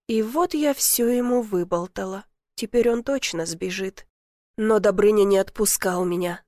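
usual speaking voice

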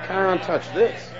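slow, drawn out with feeling; slowed down and felt, showing the congregation how; with some feeling. Shown as a demonstration. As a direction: lean into each word. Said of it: "say it with some feeling".

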